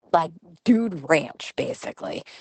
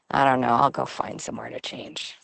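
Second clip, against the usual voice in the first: lightly imitated low-voiced teen reply; retold teenage son; 6 semitones lower than usual. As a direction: give in low, as a reluctant son. Teen son's resigned reply, retold.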